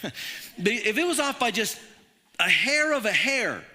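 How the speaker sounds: preacher quoting Christopher Hitchens in a mock British accent. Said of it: "he's British".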